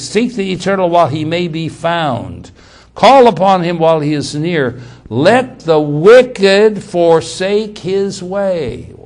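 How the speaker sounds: declamatory reading, building to a slow, loud close; scripture reading. Acted. Preacher reads Isaiah to the congregation, declaimed scripture reading. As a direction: read the verse out, stretching the final clause.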